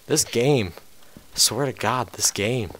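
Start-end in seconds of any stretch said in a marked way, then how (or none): none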